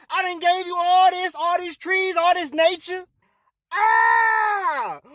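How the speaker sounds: high, ranting scolding shouts; angry God. Acted. God scolds Adam for eating, angry rant. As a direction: rage at them with drawn-out cries.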